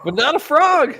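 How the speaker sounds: words squeezed out through laughter; laughing. Real, not acted. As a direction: laugh while you say it.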